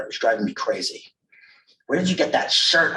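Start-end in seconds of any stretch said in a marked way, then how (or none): 1.9-3.0 blurted, higher-pitched accusing question; a touch of accusing urgency; hard accusation. Acted. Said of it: "dipping into a register of hard accusation".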